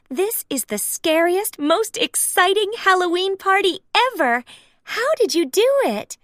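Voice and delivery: high, thrilled party praise; delighted Daisy. Acted. Daisy praises Mickey's spooky party. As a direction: gush with excitement.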